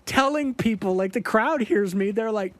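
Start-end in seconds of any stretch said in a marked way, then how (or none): 0.1-0.9 high, slow whiny plea; mock begging her to stop; pleading bandmate.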